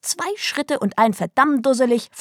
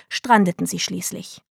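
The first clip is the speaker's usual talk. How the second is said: ordinary delivery, nothing marked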